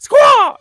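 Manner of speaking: high, loud cartoon squawk; mock shriek. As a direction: let out a goofy shrieking squawk. Wild yell after the mock voice.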